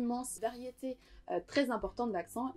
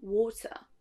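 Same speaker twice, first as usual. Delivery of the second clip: British "water", first vowel rounded like "aw"; British accent; 3 dB louder than usual. Shown as a demonstration. Teacher contrasting the first syllable, British accent on "water". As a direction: say "water" British, waw-tuh. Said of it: "une différence dans la première syllabe".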